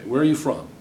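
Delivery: ordinary talk, nothing marked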